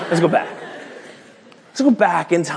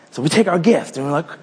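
ordinary talk, nothing marked

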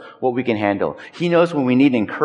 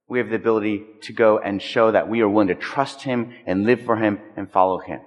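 ordinary delivery

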